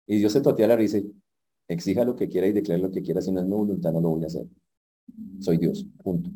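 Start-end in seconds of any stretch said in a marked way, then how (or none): 1.7-6.3 low, soft, unbothered divine reply; calm final word from above; God quietly settling it. Acted. God answers the demanding believers.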